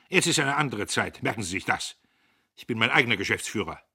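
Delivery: put-on young boss snaps at old clerk; curt anger. A narrator's reading. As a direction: lay down the law. Dieterich asserts authority.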